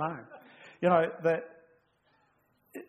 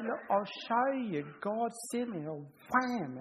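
Moses boasting; 8 semitones higher, slower than usual. Retold or imitated. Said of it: "he'd say".

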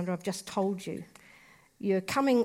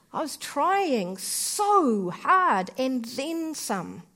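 usual speaking voice

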